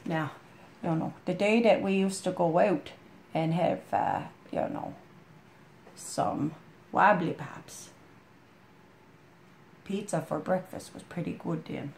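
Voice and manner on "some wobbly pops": ordinary talk, nothing marked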